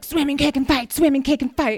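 high, loud, squeaky little-head version of the boss. Retold or imitated. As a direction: play a tiny boss yelling from her shoulder. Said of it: "this little head on my shoulder".

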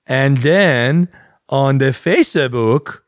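slow, sing-song mock Iranian accent. Giving listeners the email, slow mock Iranian accent. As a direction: spell it out in a drawn-out Iranian accent. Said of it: "this is Iranian".

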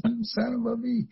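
high laughing mock curse; laughing insult. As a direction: laugh through the mild insult. Furious retort, laughing.

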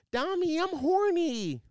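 mock high pleading friend; high mock girlfriend voice. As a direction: put on a high breathy-girl voice, drawn out. Friend's voice in retold call.

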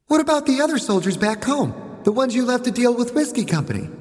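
put-on high little-girl voice; child boss, challenging. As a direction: question the general like a small boss.

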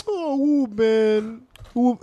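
high, wailing Haitian uncle lament; theatrical Haitian uncle. Retold or imitated. Haitian uncle greeting his nephew in a theatrical wail. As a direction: ham up the theatrical Haitian relative. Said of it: "they're so theatrical".

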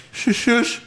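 high, hushing little retort; high-pitched mock shush. Acted retort of retold wrestler.

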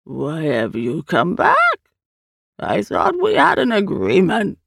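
slow, drawn-out alien voice; strange creature, accusing. Acted. The Eye confronts Hand on its return, slow and accusing. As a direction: question slowly as an otherworldly creature.

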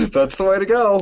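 laughing. Real, not acted. Laughing after living at home.

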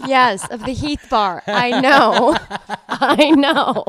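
loud, high, laughing protest; laughing exasperation. Real, not acted. Listener who knows the family story, loudly exasperated and laughing. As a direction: cry out through laughter that you've heard it already. Teasing over an often repeated story.

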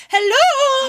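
high loud sing-song hello; mock cheery shout. Friend joking she should have waved and called out, mock cheery shout. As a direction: yell it out like a silly greeting.

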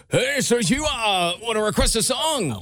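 dry, sarcastic, nonchalant DJ; fake deep radio voice. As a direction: lightly imitate his old radio DJ voice. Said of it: "very dry and very sarcastic and very nonchalant".

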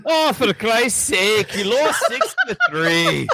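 put-on British accent. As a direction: bellow it like an angry Brit. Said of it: "do it in a British accent".

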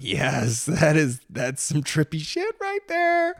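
re-enacted thrill, climbing high in pitch; hammed-up excited outburst; excited reaction. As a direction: act out being blown away, building to a high finish. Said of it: "Got me really excited".

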